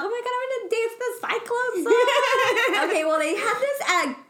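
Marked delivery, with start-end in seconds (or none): 0.0-2.9 much higher, laughing excitement; excited squeal. Retold or imitated. Retelling her thrill at the concert, squealing excitedly. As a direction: gush like a fan about to dance. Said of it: "so I was like".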